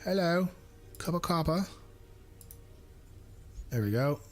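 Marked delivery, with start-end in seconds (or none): 0.0-1.6 higher-pitched mock British accent. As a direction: chirp it in a put-on British accent. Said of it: "I gotta do the accent".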